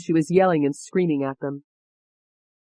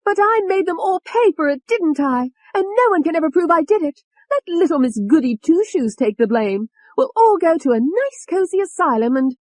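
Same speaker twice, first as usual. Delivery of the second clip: high, loud vindictive rant; worked-up, triumphant high-pitched tirade; gloating rage; 13 semitones higher, 5 dB louder than usual. A narrator's reading. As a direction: gloat furiously in a raised pitch. Enraged alter gloats over murders.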